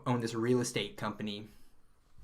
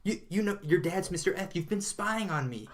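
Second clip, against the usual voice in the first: higher, hammed-up disbelief; mock shocked outburst; 7 semitones higher than usual. Retelling the sitcom scene, Michael's higher mock shocked disbelief. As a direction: play up the betrayed shock. Michael confronts girlfriend, retold joke.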